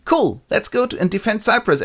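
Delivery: high, cheery rallying call; rally everyone brightly; happy Duke. Acted. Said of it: "the Duke's happy".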